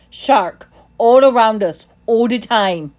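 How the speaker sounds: loud, slow angry roar. A narrator's reading. Timothy scolding the boy about sharks, loud roar. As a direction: drive the warning home, roaring. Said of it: "he roared".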